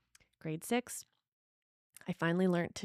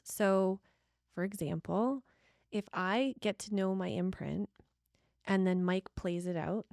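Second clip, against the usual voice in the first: ordinary talk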